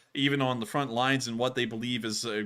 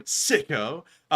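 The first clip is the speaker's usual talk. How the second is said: usual speaking voice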